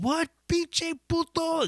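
high, slow mock Spanish retort; imitating the singer cussing. Singer cussing out the heckler in Spanish, high mock retort. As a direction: sneer it back at the heckler. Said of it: "cussing him out in Spanish".

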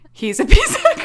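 loud, high, breaking into laughter; laughing. Real, not acted. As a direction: crack up halfway through the insult. Cracks up repeating her insult.

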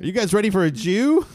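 loud, hyped club host announcement; mock crowd warm-up with a big rise on the last word; MC intro. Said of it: "gotten that as an intro".

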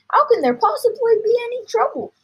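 high, loud exclaimed question; excited father protesting; incredulous cry. A narrator's reading. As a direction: scoff at the very idea, loudly. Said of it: "cried Mister Cranky".

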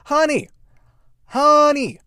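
very high, loud mock damsel cry; mock fretting lover. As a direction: squeal it like a panicked sweetheart. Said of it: "he's like".

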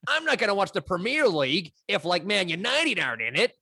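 British speaker's mock American voice; American accent. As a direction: imitate an American football listener. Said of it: "it's my American accent".

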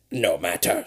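low, soft scornful wizard; scoffing wizard. A narrator's reading. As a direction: scoff it off dismissively. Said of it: "scoffs the wizard".

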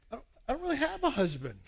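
high, quick woman's voice with a laugh; sheepish admission. Retold or imitated. Samaritan woman dodging about her husband, sheepish. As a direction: voice an awkward, laughing confession.

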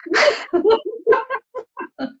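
high, wet laugh close to tears; teary laugh. Real, not acted. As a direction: laugh it off though nearly crying. Laughs near tears after being teased.

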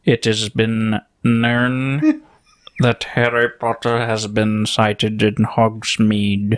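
very slow, measured mock announcement; painfully slow impression. Podcaster mimicking a teacher warning students, absurdly slow. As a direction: take forever over every word. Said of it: "graduated by the time he got done talking".

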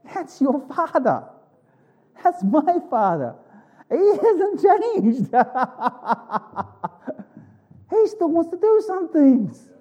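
a laughing tease about his father; playful joke. Acted. Joking about the father's miracles.

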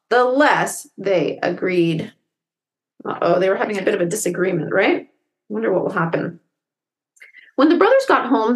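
plain reading voice

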